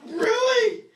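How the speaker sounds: high, loud mock outcry; loud, high-pitched incredulous yelp; exasperated disbelief. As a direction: cry out "really?!" as the trapped heroine. Acting out trapped movie heroine.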